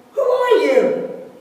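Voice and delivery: high, loud, slow call to the mountain; curious yell. A narrator's reading. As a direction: shout it out across the valley. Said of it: "the son yells".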